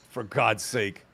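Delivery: normal talking voice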